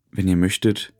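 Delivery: calm, unhurried guiding voice over music; guided meditation. Acted. Leads a guided dream journey.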